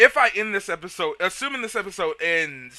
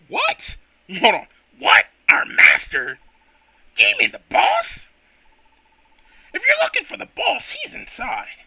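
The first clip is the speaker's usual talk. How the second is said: gruff apprentice voice; 4 semitones higher, 3 dB louder than usual. Acted. Voicing Timber apprentice.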